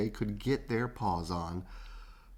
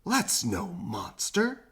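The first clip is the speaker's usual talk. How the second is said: mild cry; 6 semitones higher, slower than usual. A narrator's reading. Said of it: "cried Oscar".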